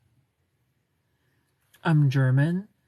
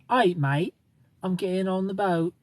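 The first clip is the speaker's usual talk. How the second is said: put-on Australian accent, pitched up a little; 4 semitones higher than usual. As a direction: play a mock Australian heading aboard. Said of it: "See, that's Australian".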